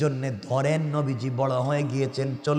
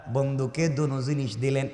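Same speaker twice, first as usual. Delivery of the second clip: ordinary talk, nothing marked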